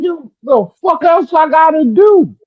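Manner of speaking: high-pitched mimicked dad protest; exasperated father imitation, very high; dying father. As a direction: plead it as Dad, voice pushed way up. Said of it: "He's like".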